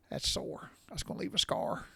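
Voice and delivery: whispered, a soft pained aside; whispered wince. Retold or imitated. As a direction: breathe it out as a wince. Thoughts after feeling the wound.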